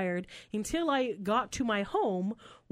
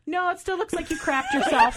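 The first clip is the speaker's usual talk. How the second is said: laughing insistence; 8 semitones higher, 7 dB louder than usual. Retold or imitated. Retells insisting while laughing.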